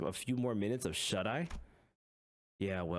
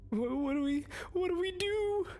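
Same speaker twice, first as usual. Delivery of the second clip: panicked Sinclair. Acted. Sinclair asking the manager for help, high panicked voice. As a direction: squeal it like a terrified kid. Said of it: "how I just hear Sinclair in my head".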